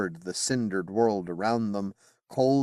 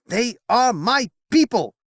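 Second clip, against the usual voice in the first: much higher and louder defiant shout; king shouting back while riding off; 7 dB louder than usual. Acted. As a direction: fling it back over your shoulder. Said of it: "he shouted across his shoulder".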